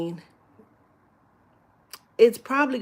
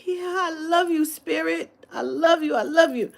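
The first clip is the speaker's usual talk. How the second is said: a much higher, over-emotional retold "I love you"; teary gushing; 9 semitones higher than usual. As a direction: gush it out like you're about to cry. Retelling tearful moment on toilet.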